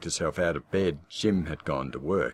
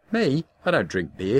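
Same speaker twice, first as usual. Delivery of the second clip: indignant surprise; 8 semitones higher, 4 dB louder than usual. Acted. Wife protests accusation.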